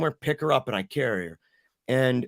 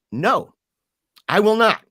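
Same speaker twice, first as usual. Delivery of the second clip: loud, raised, defiant retelling; firm refusal; 7 semitones higher, 8 dB louder than usual. Retold or imitated. Father told to close the door, loud refusal. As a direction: plant each word loudly, refusing to give in.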